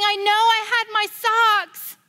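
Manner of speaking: high, loud tearful insisting; small girl crying over her socks. Retold or imitated. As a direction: plead through tears. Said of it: "She's just standing there crying".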